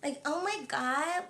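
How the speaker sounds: soft, drawn-out annoying American accent; mock the irritating American voice, stretching each word; slow, quiet parody of American reality-star talk; Kardashians. Shown as a demonstration. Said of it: "that voice is so annoying".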